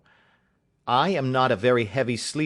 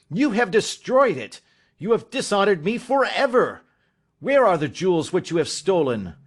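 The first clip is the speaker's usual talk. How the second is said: loud, much higher raging accusation; beside himself with rage; 6 semitones higher, 4 dB louder than usual. Acted. Father raging at his son over the ruined coronet. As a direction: rage at him, nearly out of control. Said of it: "beside myself with rage".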